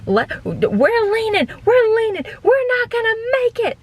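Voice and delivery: high, panicked over-the-top boat guide; hammed-up, stretched-out cry of impending doom; wild crazy skipper. Skipper crying out to boat passengers, wild and crazy. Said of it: "the wild, crazy".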